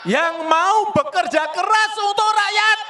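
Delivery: rising to a fiery shout; building rally shout. Real, not acted. Rising rally speech climax.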